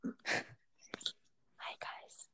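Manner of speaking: soft unvoiced whisper intro; ASMR whisper. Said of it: "Do, should I do some A S M R?".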